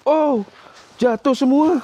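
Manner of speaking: high, loud alarmed exclamation; startled cry. Real, not acted. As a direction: cry out as things fall. Phones fall, startled cry. (seruan kaget keras)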